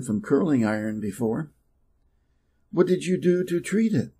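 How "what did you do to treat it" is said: usual speaking voice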